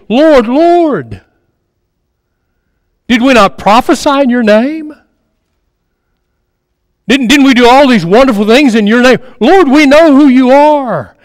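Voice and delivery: lightly voiced begging of people at the judgment; pleading crowd. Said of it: "And Jesus will say to them".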